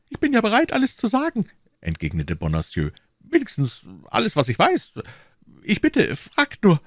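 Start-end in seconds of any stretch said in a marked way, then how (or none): 0.1-1.4 high, pleading voice; eager-to-please prisoner. Acted. Frightened prisoner offers to confess. 3.2-6.8 high, pleading voice; eager-to-please prisoner. Acted. Frightened prisoner offers to confess.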